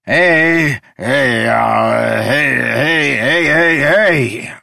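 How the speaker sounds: put-on loud, high drunken snarl. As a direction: snarl to cut him off. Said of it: "Udo snarled".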